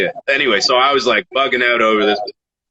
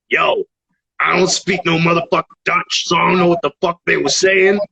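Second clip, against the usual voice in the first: higher-pitched, put-on emphatic rapper voice; 7 semitones higher than usual. Judge outside praising the losing contestant, imitated rapper voice. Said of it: "[name] is outside or whatever, walks up and he's like".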